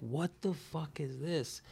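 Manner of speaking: soft, slow stunned disbelief. Retold or imitated. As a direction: mutter it, unable to believe it. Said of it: "And I'm just like".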